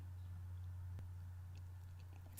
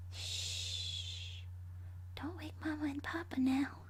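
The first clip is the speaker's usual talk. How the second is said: put-on creepy childlike whisper; whispered shush; 6 semitones higher, 5 dB quieter, slower than usual. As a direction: hush him like a sleeping house. Ghost girl hushes victim.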